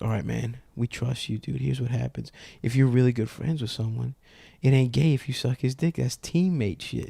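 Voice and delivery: quietly letting him in on the secret; hushed confiding. Retold or imitated. Teammate confides secret plan.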